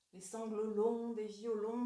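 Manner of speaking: slow, drawn-out verse reading; lingering recitation. Shown as a demonstration. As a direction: savor "longs", stretching it out nostalgically. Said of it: "renforce l'impression de nostalgie". (lecture lente qui étire « longs »)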